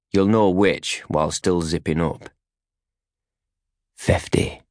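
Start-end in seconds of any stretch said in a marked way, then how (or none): 4.0-4.6 quiet, almost unvoiced command; a low hushed order; hushed one-word command; said quietly. A narrator's reading. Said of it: "She says it quietly".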